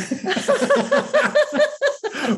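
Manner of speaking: long, very high peal of laughter; real hearty laughter. Real, not acted. Speaker cracking up at own bathroom joke, real laughter. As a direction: crack up helplessly.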